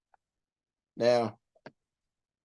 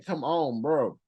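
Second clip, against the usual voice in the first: ordinary delivery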